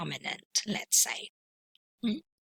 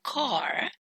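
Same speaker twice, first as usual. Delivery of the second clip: American "car" with the final R pronounced; General American; 5 semitones higher, 4 dB quieter than usual. Shown as a demonstration. Teacher contrasting two pronunciations, "car" in General American with R. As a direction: say "car" in rhotic General American, R sounded after the vowel. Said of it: "that belongs to General American".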